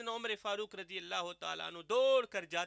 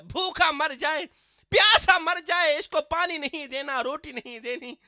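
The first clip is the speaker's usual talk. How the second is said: loud, higher hammered-out decree; mock harsh decree; 5 semitones higher, 8 dB louder than usual. Preacher imitating a sectarian telling children to deny a neighbour water, loud. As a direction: mimic the bigot's cruel command. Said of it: "bigoted instruction".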